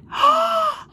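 high sharp gasp; excited gasp. Acted. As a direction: gasp in wonder. Girl sees the web.

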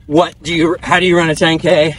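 ordinary talk

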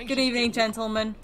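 normal talking voice

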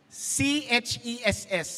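letters spelled slowly one by one. Shown as a demonstration. Preacher spelling the joke name to the church, slow. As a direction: spell each letter out deliberately. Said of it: "spelling out the name".

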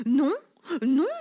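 cake refusing. A narrator's reading. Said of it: "dit la galette".

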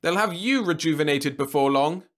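put-on high, loud, sarcastic old man; mock her with heavy sarcasm. A narrator's reading. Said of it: "facetiousness and downright sarcasm".

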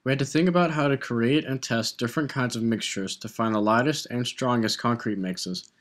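ordinary delivery

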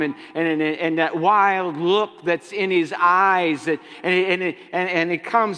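loud, high, driving sermon delivery; full-force preacher cadence; fiery preaching. Acted. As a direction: preach it hard to the congregation.

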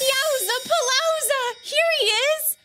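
loud, high, excited greeting; host cheers as Shine arrives, excited; excited welcome. Acted. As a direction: announce his arrival with big excitement.

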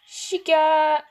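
loud, high, held call. Real, not acted. Player calling Toy Chica for a hug, excited shout. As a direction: shout the name and stretch it.

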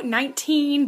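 ordinary delivery

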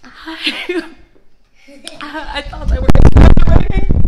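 shouting in disbelief; loud exclamation. Real, not acted. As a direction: shout it out, stunned. Shocked by the spice.

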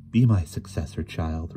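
ordinary delivery

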